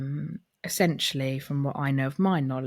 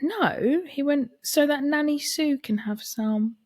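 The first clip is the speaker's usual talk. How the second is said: put-on child voice; 11 semitones higher than usual. Nephew earnestly explaining.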